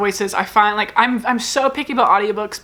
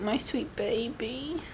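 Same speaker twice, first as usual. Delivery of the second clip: soft unvoiced whisper to the pet; whispered endearment; 14 dB quieter, slower than usual. Real, not acted. Holding her pet close, a tender whisper.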